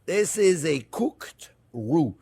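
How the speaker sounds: cooked roux, slow and deliberate, every sound clear; careful slow "cooked roux" with a clear final d; said slowly. Shown as a demonstration. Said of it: "I'm saying it slowly".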